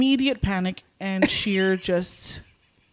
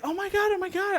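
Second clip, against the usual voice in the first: alarmed exclamation; 12 semitones higher, 4 dB quieter than usual. Retold or imitated. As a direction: mimic a friend fretting in a high voice. Retells Alex's alarmed reaction.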